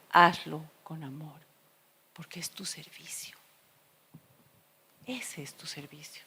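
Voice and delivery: soft, low near-whisper. Retold or imitated. The Lord's voice answering her complaint, very soft. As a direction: gently hush the words. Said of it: "una voz muy suave".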